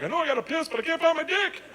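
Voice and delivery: high-pitched, hammed-up drunk; drunk person. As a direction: play a baffled drunk, voice way up. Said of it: "You ever been so drunk you're like".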